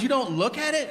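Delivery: high, laughing disbelief; disbelieving wife. Retold or imitated. Wife asking her husband in high disbelief. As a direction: ask it in high incredulity.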